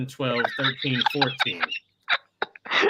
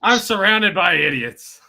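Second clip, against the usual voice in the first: exasperated villain; 3 semitones higher, 8 dB louder, slower than usual. Acted. Voicing exasperated villain Hordak.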